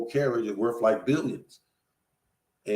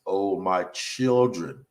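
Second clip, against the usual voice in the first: put-on slow, drawn-out "oh my children"; slow rereading; 3 semitones lower, much slower than usual. Shown as a demonstration. Preacher rereading scripture to the congregation, slowly. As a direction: stretch out each word, lower in pitch. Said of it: "let me read that again slowly".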